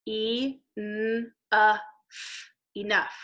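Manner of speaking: sounds said apart then enough; teacher sounding out enough. Shown as a demonstration. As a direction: segment E, n, uh, f then blend enough. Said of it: "there's our G H".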